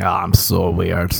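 low, drawn-out "so weird"; mock LA beautiful person. Beautiful people in LA describing themselves, in a mock affected voice. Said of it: "And they'll be like".

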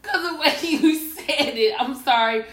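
high, loud, laughing apology; helpless real laugh while saying sorry. Real, not acted. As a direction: explain yourself through laughter. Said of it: "Why are you laughing?".

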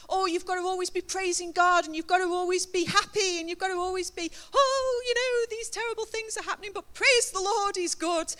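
high, loud, over-bright praise voice; mock churchy gushing. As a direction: ham up the always-happy churchgoer. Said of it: "we can make it seem like".

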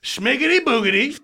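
lightly put-on high nonsense babble; high-pitched gibberish impression of mom; mimicked mother. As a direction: blurt it in a mock high voice. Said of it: "And she just goes".

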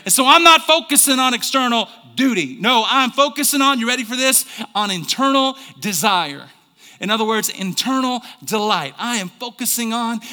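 loud, high, driving sermon; full-force preaching. Real, not acted. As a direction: pound out each phrase to the congregation.

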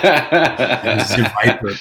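put-on drawn-out G.I. Joe villain accent, amused. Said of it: "that accent like in G I Joe".

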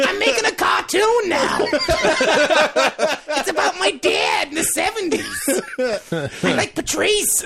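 put-on very high, slow, shouted old-mom shriek; Bill Burr as Estelle. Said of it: "my Bill Burr is just Estelle Costanza".

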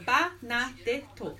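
"Ba na de to" in separate beats; Japanese syllable-by-syllable. Shown as a demonstration. Friend works out Bernadette in Japanese, syllable by syllable. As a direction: sound out "Ba na de to" syllable by syllable. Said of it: "so you would go".

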